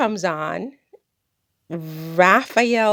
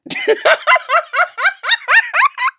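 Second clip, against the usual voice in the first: long high laughter; 5 dB louder than usual. Real, not acted. Delighted at her own Creole insult, laughing high.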